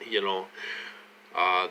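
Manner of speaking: ordinary delivery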